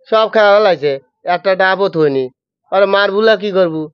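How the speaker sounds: Pabna dialect, calling out a workman; lightly voiced Pabna villager scolding a thief. Shown as a demonstration. Guest picks Pabna dialect and acts out the coconut theft. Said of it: "ওই পাবনা".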